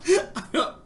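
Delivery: real laugh breaking into the words. Real, not acted. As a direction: crack up at your own joke. Laughing at own joke aside.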